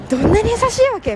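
high, loud amazed exclamation; amazed disbelief. Retold or imitated. Recalling the friends who comforted her, amazed. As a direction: marvel at how kind they are.

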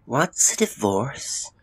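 put-on high, slow little boy's question; small boy, curious and slow; puzzled child. A narrator's reading. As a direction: ask slowly in a high child's voice. Young boy asks puzzled question.

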